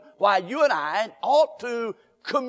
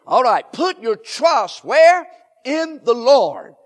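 rally the congregation, slowly and loudly; loud call and response; 4 semitones higher, 8 dB louder than usual. Shown as a demonstration. Preacher calling to the congregation for the answer, loud and drawn out. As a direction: ask "where" high and loud, drawn out, then answer. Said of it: "One more time".

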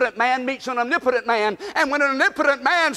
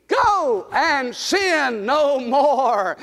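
slow, drawn-out declaration; slow sermon refrain, every word weighted; slow emphatic; much slower than usual. Shown as a demonstration. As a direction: lay each word down slowly. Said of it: "Say it with me".

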